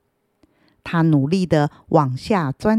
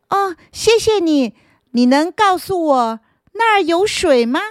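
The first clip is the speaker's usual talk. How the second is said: higher, louder grateful question; grateful root; 10 semitones higher, 4 dB louder than usual. Acted. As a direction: thank him and ask eagerly. Root thanks the earthworm.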